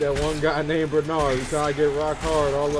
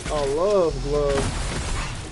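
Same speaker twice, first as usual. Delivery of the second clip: slow, drawn-out; much slower than usual. Real, not acted. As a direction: stretch out "A love glove" very slowly. Savouring the punchline.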